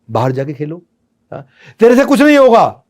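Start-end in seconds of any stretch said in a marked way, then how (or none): 1.8-2.8 loud high scornful shout; harsh put-down. Shown as a demonstration. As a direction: sneer it like a fed-up parent. Harsh parent quote.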